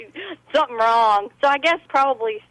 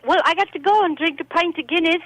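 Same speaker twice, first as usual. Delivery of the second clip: higher-pitched imitation Irish brogue; mock Irish accent; 6 semitones higher than usual. Quoting an Irish guest, playful mock Irish accent. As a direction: imitate an Irishman heading off for Guinness. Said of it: "and then he's like".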